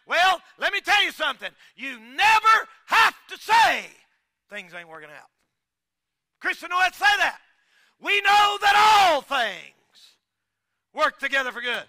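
loud, high, rising preacher's cry; shouting sermon climax; preaching at full force. Acted. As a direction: preach it hard to the congregation.

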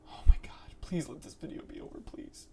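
frightened fast whisper; scared breathless plea, barely voiced; terrified whisper. Real, not acted. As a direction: beg in a hushed whisper for it to end. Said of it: "I'm petrified".

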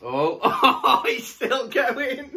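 high slow words through laughter; laughing helplessly. Real, not acted. Laughing at partner.

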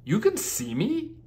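a unicorn amazed she is seen; startled unicorn question; surprised. Acted. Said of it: "replied Alchemy, surprised".

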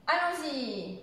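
exclamation with a drawn-out end on ALLONS-Y; teacher's model exclamation, lengthened final "y"; exclamative intonation. Shown as a demonstration. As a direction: hold the last syllable of "allons-y". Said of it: "Phrase exclamative".

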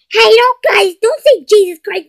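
high, loud reprimand; scolding. Acted. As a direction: cut them off for swearing. Scolding boys for swearing.

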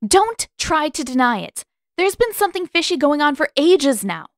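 high, loud and indignant; stormy. A narrator's reading. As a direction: storm up and demand it. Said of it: "Katara stormed up to Pakku".

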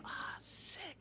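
breathy whispered gasp then a high exclaim; a hint of mock delighted gasps; mock gushing dancer. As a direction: act out an overexcited dancer reacting. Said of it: "She'll be like".

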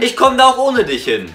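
loud and high, demanding out; angry shout. Acted. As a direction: yell to be let out. Couple quarrel in the car. (wütend schreien)

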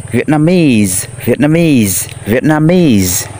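"Vietnamese" drawn out, falling at the end; model pronunciation. Shown as a demonstration. Teacher has pupils read after him, drawn-out model pronunciation. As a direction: say "Vietnamese" slowly for pupils to repeat. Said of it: "mời mấy em đọc theo thầy ha".

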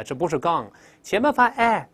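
normal talking voice

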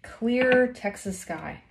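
plain reading voice

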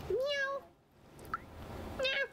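high squeaky meow; cat meow. Acted. Voicing the cat.

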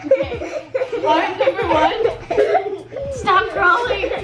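breathless and laughing; panting while you talk; out of breath. Real, not acted. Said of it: "I'm out of breath already".

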